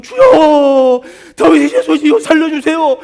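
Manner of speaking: high, loud, acted desperate wail; wailing plea. Woman following in tears as Jesus walks on, wailing plea. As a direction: beg at full voice, as if sobbing after someone. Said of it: "막 울부짖으면서".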